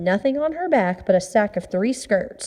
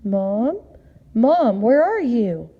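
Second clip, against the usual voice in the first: slow, searching call in the dark; much slower than usual. Acted. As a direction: call out for mom, slowly. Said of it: "and called".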